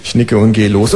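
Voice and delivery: ordinary talk, nothing marked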